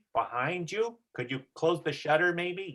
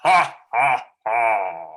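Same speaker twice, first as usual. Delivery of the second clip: very loud laugh, muffled through cupped hands; booming laugh through hands; 3 semitones lower, 8 dB louder, much slower than usual. Shown as a demonstration. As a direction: let it echo like from a cave. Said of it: "Try that".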